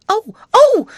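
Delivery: loud, very high shriek of alarm; startled scream. A narrator's reading. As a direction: scream out in sudden fright. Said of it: "she screamed".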